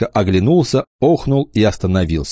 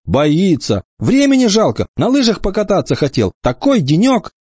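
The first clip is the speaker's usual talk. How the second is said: put-on high, hurt boy's protest; a boy taking offence, pleading; offended protest; 11 semitones higher than usual. A narrator's reading. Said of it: "Валька обиделся". (обиженно, высоко)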